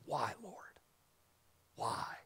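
soft, breathless whisper to God; whispered plea. Retold or imitated. Preacher voicing an unanswered prayer, whispered. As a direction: plead in a hushed whisper.

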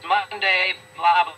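hammed-up Monday moan; mocking Monday whiners. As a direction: drag it out like a bored gym class. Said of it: "I said to them".

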